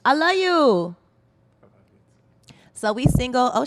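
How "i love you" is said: called-out affection. Real, not acted. Host calls out affection after disclaimer.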